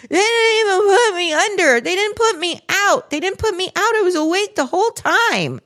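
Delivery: put-on high, shrill-pitched indignant teen protest; mock high whiny daughter; upset daughter. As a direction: squeak it out like the groggy kid insisting. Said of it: "she's like".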